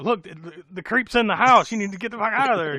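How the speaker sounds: high, loud mock-panicked warning; hammed-up urgent alarm; panicked blurting. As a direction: act out losing all composure. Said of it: "he just shits the bed".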